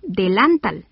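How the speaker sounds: "delantal" wrongly stressed on the middle syllable, de-LÁN-tal; "delantal" said as delántal; stress on LAN. Shown as a demonstration. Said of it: "ni tampoco".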